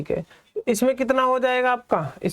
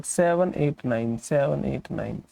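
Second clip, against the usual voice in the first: "Seven eight nine" slow and lower, digit by digit; separate digits; 6 semitones lower, 3 dB quieter, slower than usual. Shown as a demonstration. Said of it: "बिल्कुल अलग अलग".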